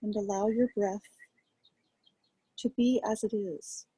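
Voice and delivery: calm, quiet guiding voice with long pauses; guided meditation. Acted. Teacher guiding a group to rest attention on breath, calm meditation voice. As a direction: ease listeners gently into noticing the breath, unhurried.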